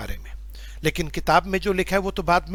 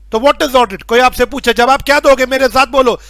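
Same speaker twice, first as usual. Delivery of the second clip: loud; 4 semitones higher, 7 dB louder than usual. Real, not acted. Teacher rallying class loudly.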